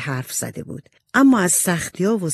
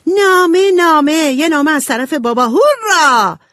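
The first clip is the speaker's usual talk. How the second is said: much higher and louder, cheering over a letter; excited shout; 12 semitones higher, 7 dB louder than usual. A narrator's reading. Jo throws her napkin and shouts with joy about the letter. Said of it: "داد زد".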